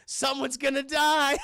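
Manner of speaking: high, loud, drawn-out mock doom; slow hammed-up cry; dramatic proclamation. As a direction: call it out like a gleeful warning. Sudden dramatic proclamation to chat.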